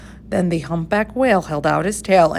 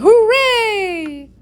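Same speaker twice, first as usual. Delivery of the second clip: much higher and louder, a cheer; cheering shout; 4 dB louder than usual. A narrator's reading. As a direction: shout it out in triumph. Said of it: "villagers shouting".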